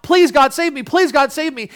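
high, loud desperate plea; pleading. Retold or imitated. Sinner begging God for rescue, high desperate plea. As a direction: beg God to save you. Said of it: "pleading out".

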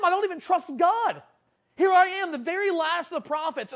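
high, anguished self-reproach; acted impassioned confession; broken prophet. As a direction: lament your sin with rising volume. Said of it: "Elijah is so broken over his sin".

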